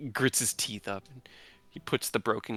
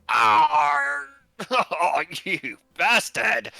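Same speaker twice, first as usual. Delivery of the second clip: loud, high yelp of pain; slow, loud wounded curse; pained cry; 10 semitones higher, 6 dB louder, much slower than usual. Acted. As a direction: cry out hurt then curse. Mark cut, crying out.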